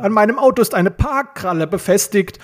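high-pitched, appalled exclamation; exasperated cry of dismay; despairing. A narrator's reading. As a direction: wail about it in disbelief. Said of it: "rief Peter und fuhr sich verzweifelt".

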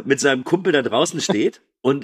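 normal talking voice

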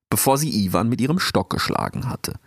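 ordinary delivery, nothing marked

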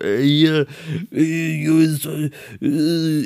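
failed attempt at imitating a cursing grumbler; Mario Basler. Imitating a reality star arguing and cursing, mock grumble. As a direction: lightly mimic wordless grumbling. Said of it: "ich kann ihn nicht nachmachen".

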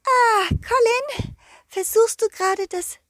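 tiny, high garden fairy; a sweet fairy voice, very high. Acted. Fairy character voice.

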